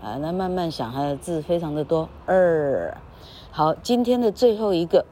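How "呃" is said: 呃 held as "errr"; gliding r-coloured 呃; long curled vowel. Shown as a demonstration. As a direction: stretch 呃 with the tongue curled. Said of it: "但是它还可以发长音，".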